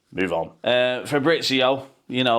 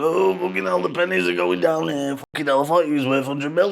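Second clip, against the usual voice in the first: higher, lightly put-on panic; a touch of mock dismay; imitating Jim; 5 semitones higher than usual. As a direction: voice the owner watching money drain. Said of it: "Jim's watching it going".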